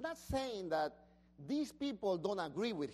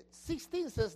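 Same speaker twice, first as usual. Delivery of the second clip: usual speaking voice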